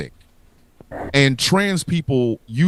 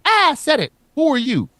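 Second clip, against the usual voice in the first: high comic bickering; 9 semitones higher than usual. Pretend. States bickering, acted.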